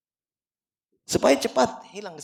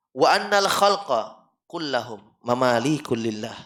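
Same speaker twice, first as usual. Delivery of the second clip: slow Quranic recitation; 8 dB louder, slower than usual. Shown as a demonstration. Quoting a Quranic verse.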